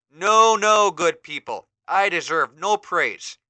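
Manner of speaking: lightly voiced humble hero, slow; modest hero. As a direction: wave off the praise. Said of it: "He-Man begins to reply".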